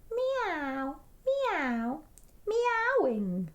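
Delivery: high, gliding meows; cat meowing. A narrator's reading. Narrator lists the sounds Edward hears, meowing.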